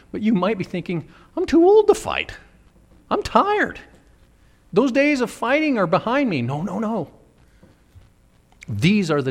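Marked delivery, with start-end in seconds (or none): 1.4-6.4 put-on high-pitched old-timer's complaint; mock too-tired whine; weary excuse. Voices weary listeners' excuse to not fight.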